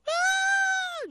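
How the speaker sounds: very high, loud acted scream; long shrill shriek of fright; scream of fear. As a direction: let out a sustained, dramatized scream. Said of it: "Y dieron voces de miedo".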